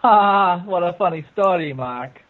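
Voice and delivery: ordinary delivery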